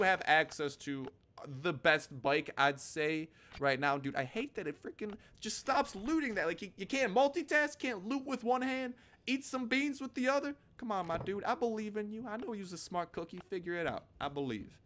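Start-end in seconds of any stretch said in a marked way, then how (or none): 4.2-10.5 squeaky frustrated whine; high whiny complaint. Real, not acted. High complaint at game looting. 10.8-14.0 high, soft encouragement; soft coaxing. Acted. Coaxing the game character.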